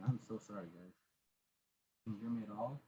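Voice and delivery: ordinary talk, nothing marked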